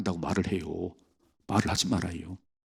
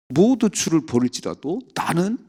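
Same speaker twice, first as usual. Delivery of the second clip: boastful Peter; 9 semitones higher, slower than usual. Retold or imitated. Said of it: "이런 사람 제일 먼저 도망가요".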